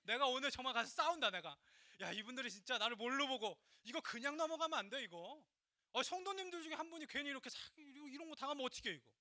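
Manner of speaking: heated rant, loud and high; retold anger. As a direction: act out working yourself up for a fight. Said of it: "하나님께 너무 화가 났어요". (화나서 따지는 재연)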